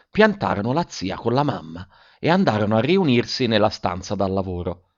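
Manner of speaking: ordinary delivery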